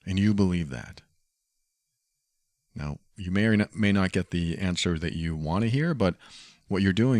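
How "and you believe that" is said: "And you believe that?" with a steady falling end on "that"; more commanding. Shown as a demonstration. Facing a blind follower, a commanding falling "And you believe that?". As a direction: say it flat and firm, like a statement. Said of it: "It's more commanding".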